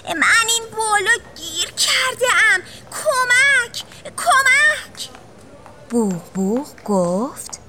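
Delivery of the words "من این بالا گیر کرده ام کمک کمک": high, fast cry for help from up a tree; a stuck child yelling help, pitch climbing; panicked high call for help; shouting for help. Acted. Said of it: "توپلو داد زد".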